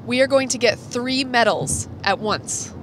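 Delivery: ordinary talk, nothing marked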